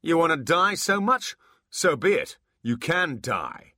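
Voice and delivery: loud, high threat, slow at the end; menacing. Acted. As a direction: threaten them with death. Abbott threatens to kill.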